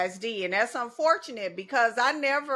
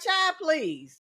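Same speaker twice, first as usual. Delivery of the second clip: dismissive lady; 6 semitones higher than usual. Retold or imitated. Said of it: "She was like".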